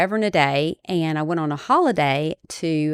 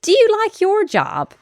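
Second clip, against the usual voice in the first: lightly pitched-up retold question, much higher; a hint of nervous eagerness, raised voice; retold eager question; 4 dB louder than usual. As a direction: pop the question up high and eager. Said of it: "So then I said".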